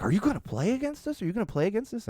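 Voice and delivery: put-on high, eager child's voice repeating the question; an excited young soccer player asking her dad; excited kid asking. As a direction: play the little girl pestering her coach-dad. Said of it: "She's like".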